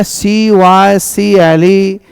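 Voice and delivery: letter by letter, very slow; spelling aloud slowly. Shown as a demonstration. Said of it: "हम लोग देख रहे हैं ना".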